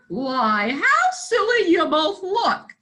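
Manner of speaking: high, amused mock dog voice; dog's voice. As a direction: laugh at how silly they look. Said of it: "said the dog".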